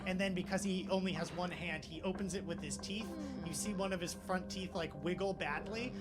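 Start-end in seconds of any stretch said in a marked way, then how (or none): none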